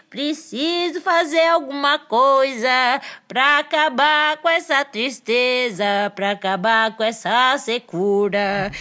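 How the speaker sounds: slow, worried little monkey voice; thirsty monkey voice. Acted. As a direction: drag it out, parched and pleading. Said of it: "Pois ele pensou".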